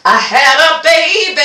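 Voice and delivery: a triumphant sing-song reveal; proud sing-song. Acted. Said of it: "What I did was".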